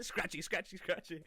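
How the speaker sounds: high, loud, giggly cooing; laughing baby talk to a dog; playful pet talk. Acted. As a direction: scratch a dog's belly and giggle along. Scratching the dog's belly.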